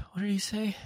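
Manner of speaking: normal talking voice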